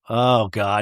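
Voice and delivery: usual speaking voice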